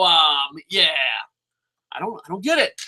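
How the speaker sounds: normal talking voice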